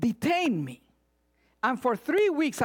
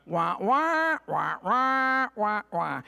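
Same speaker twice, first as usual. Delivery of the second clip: muffled wah wah trombone voice; Peanuts teacher; 3 semitones lower, slower than usual. Retold or imitated. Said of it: "the sound of the teacher".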